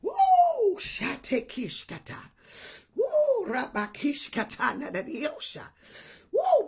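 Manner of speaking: whoop, then drop into tongues; ecstatic tongues. Real, not acted.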